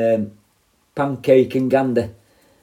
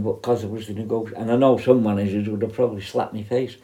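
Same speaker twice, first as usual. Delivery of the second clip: ordinary delivery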